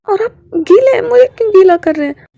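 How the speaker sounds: high, loud, startled protest. Acted. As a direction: yelp it out in shock, quickly. Wing protests being held. (पकड़े जाने पर चौंककर ऊँची आवाज़ में विरोध)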